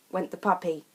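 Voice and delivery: ordinary delivery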